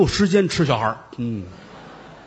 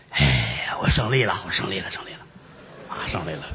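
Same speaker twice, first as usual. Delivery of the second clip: low, fading voice of a spent monk, mildly voiced; worn-out monk murmuring he won; exhausted relief; 8 semitones lower, 6 dB quieter than usual. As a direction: gasp out the victory with nothing left. Monk exhausted after long battle.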